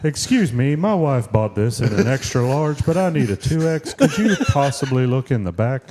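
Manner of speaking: polite cartoon dad at a store counter; straight-faced cartoon dad impression; Hank Hill. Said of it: "what if Hank Hill was returning a shirt".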